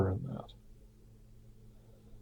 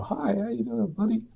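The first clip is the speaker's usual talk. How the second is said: much higher, softer fake-friendly greeting; faking it; 10 semitones higher, 7 dB quieter than usual. Debtor faking friendliness to the man he owes, hollow. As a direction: greet him with hollow put-on cheer. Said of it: "no way of being with you outside of faking it".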